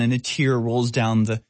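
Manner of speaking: ordinary talk, nothing marked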